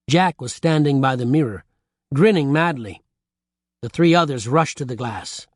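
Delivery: usual speaking voice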